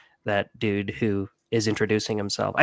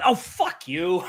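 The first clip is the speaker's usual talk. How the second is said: mock disgust; 6 semitones higher, much slower than usual. Scoffing at the faker's accent.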